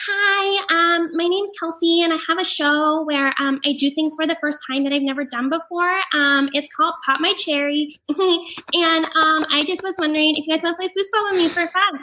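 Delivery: high-pitched, put-on sorority girl with a giggle; horrific sorority voice. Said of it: "like, this horrific sorority voice".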